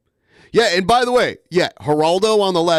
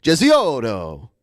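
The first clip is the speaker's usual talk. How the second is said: Gesiotto slow and rolled, hammed-up Italian accent; fake Italian accent. Shown as a demonstration. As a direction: drag out Gesiotto like a mock Italian. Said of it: "I just try to say it with a fake Italian accent".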